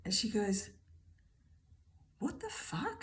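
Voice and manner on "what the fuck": a higher, stunned whispered curse; shocked whisper. Retold or imitated. Friend seeing her long-lost necklace, a shocked whisper. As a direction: breathe the curse out in disbelief.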